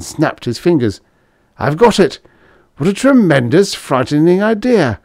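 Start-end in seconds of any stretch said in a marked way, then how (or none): 1.6-5.0 high, loud burst of excitement; excited brainwave. A narrator's reading. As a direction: exclaim it as a sudden eureka. Hero hit by a sudden idea.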